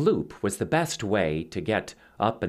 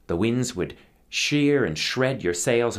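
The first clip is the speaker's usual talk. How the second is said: ordinary delivery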